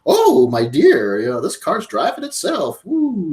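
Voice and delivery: drawn-out, swooping mock delight; high playful whoop of a thrilled rider; delighted passenger. Retelling a woman's first self-driving car ride, mock delighted.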